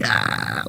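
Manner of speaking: low hushed kung fu exhale; a quiet martial-arts breath out; kung fu grunt. Retold or imitated. Healer aiming energy, low kung fu grunt. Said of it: "he's, like, down like this".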